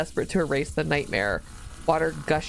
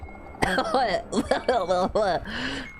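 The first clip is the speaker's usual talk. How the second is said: high laugh; 6 semitones higher than usual. Real, not acted. Reacting to gross story scene.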